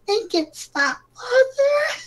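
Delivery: put-on very high pleading girl's voice; tearful princess plea. Princess begging her father, very high and tearful. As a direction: beg her father through tears. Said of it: "she said".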